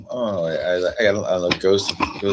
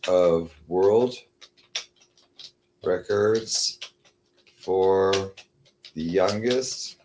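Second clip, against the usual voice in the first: word by word, very slow; slow dictation; 3 semitones higher, much slower than usual. Shown as a demonstration. Typing a query to the chatbot, dictating slowly. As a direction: dictate each word clearly. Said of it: "I'll get all the names".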